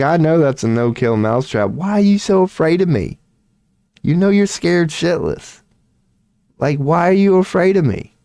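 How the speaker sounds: mock mouse voice. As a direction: tease as a cocky little mouse. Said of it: "He's like".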